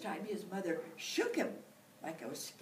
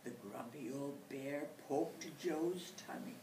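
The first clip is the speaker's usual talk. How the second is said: ordinary delivery, nothing marked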